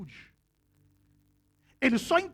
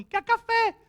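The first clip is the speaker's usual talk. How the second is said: parrot squawk; 10 semitones higher, slower than usual. Retold or imitated. Preacher imitating grandma's parrot calling out, high squawk. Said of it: "ele falava assim".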